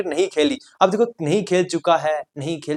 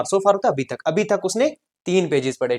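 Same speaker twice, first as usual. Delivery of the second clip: normal talking voice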